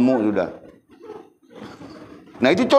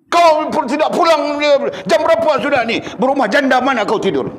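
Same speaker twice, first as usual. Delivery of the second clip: furious shout; 5 dB louder than usual. Acted. Wife screaming at husband who came home late. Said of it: "Marah dia".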